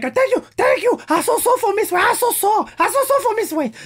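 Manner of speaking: very high, squeaky mock accented English; Yoel's little voice. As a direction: imitate a fighter's tiny, pleading falsetto. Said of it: "Avec sa petite voix". (petite voix très aiguë, imitation moqueuse)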